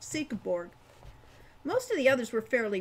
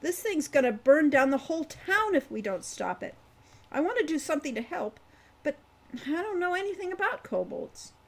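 put-on eager young voice, higher and quicker; eagerly; 6 semitones higher, 4 dB louder than usual. A narrator's reading. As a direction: rush it out, keen to help. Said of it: "said Hawk eagerly".

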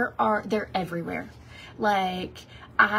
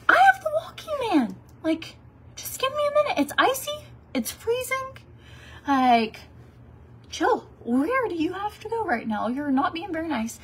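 much higher, pleading and fed up; exasperated plea; 9 semitones higher than usual. Pretend. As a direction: beg the honking drivers to chill out, high and indignant. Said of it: "and I wanna be like".

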